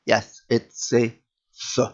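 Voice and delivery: blown sound with rounded lips; soft blown th-like sound; demo sound. Shown as a demonstration. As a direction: blow air through rounded lips, tongue in the middle. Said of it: "try it now".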